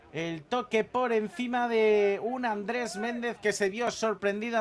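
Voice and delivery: loud, high goal call; breakneck goal commentary. Real, not acted. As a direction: shout the goal with delight. Goal scored, commentator erupts.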